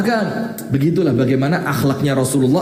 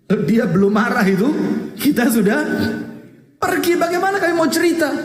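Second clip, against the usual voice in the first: climbing higher and faster; rising excitement; 10 semitones higher than usual. Retold or imitated. Preacher to congregation, excitedly retelling Aslam. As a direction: pitch shoots up on pergi, then a fast rush.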